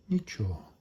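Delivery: ordinary delivery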